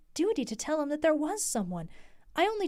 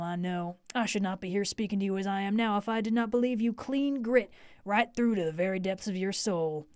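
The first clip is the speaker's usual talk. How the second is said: much lower, earnest manly suitor; manly fervor; 5 semitones lower than usual. Acted. Texan suitor earnestly asks Lucy about a rival, in manly fervor. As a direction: plead sincerely, man to man. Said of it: "with a sort of manly fervor".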